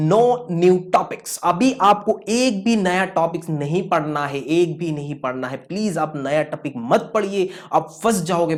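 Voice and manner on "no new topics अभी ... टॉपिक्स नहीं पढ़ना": slow emphatic. Shown as a demonstration. Teacher stresses third rule.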